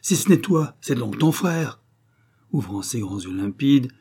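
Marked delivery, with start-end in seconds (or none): 0.0-1.8 raised, louder accusation; exclaiming wolf. A narrator's reading. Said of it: "Sébastien s'écria".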